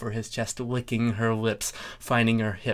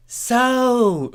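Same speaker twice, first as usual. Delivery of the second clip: Thorax voice; 13 semitones higher, 7 dB louder than usual. Acted. Thorax character.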